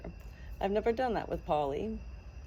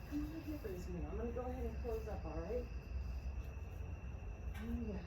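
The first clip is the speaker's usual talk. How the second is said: much softer, hushed coaxing; soft bedtime voice; 12 dB quieter than usual. Real, not acted. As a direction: settle the bird down gently. Settling the rooster for bedtime.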